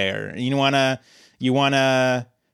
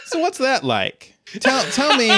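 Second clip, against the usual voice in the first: high-pitched, asked through laughter; high laughing curiosity; laughing question; 11 semitones higher than usual. Retold or imitated. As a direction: giggle out the question, barely holding it together. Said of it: "to be like".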